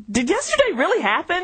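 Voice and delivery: stunned, asking yourself; disbelief. Retold or imitated. Woken after two hours' sleep, she wonders in disbelief, pitched high.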